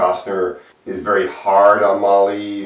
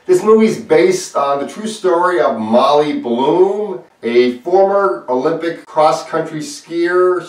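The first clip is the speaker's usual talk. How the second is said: higher, louder presenter voice rolling out the credits; upbeat review intro; 10 semitones higher, 6 dB louder than usual. Acted. Reviewer opening the video to viewers, bright presenter voice. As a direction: hype up the cast like a trailer host.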